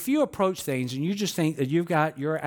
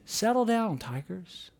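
softly, calming the team down; hushed coach quote; 5 dB quieter, slower than usual. Retold or imitated. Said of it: "In the words of the theologian Les Miles".

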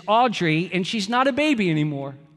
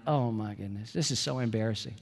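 embarrassed; 10 semitones lower, 9 dB quieter than usual. Retold or imitated. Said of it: "And I'm like".

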